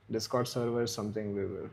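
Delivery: normal talking voice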